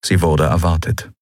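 plain reading voice

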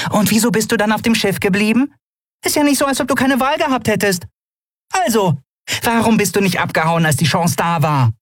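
high-pitched needling questions; challenging Nelen. Acted. Nelen asking Keru why he stayed, high challenge. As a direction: press him in a high, small voice.

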